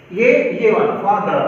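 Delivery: usual speaking voice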